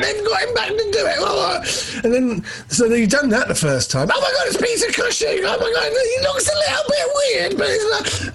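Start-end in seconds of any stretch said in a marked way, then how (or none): 0.0-2.0 ecstatic falsetto shriek, words stretched out; screaming fan. Acted. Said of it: "stop screaming in my head". 4.1-8.3 shrieking falsetto amazement; screaming fan. Acted. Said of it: "Your head is screaming".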